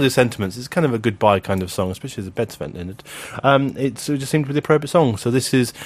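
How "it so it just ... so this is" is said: ordinary delivery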